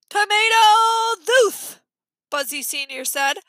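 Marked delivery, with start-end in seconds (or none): 0.1-1.8 loud, high, drawn-out congested announcement; stuffed-nose voice. A narrator's reading. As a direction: proclaim the remedy through a pinched nose. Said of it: "they had the worst colds".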